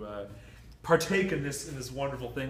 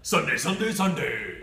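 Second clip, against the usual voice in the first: monster truck announcer; 2 semitones higher, 9 dB louder, slower than usual. Pretend. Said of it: "that's where you say Sunday a bunch of times".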